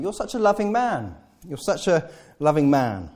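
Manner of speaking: high gushing praise; laying it on. Retold or imitated. Paul flattering Philemon, high gushing praise. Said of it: "Paul is telling Philemon".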